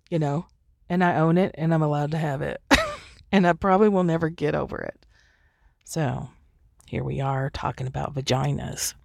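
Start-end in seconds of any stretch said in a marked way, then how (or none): none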